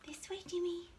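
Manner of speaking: soft high whisper; whispering to a dog. Real, not acted. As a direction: whisper to coax a pet. Coaxing dog during grooming.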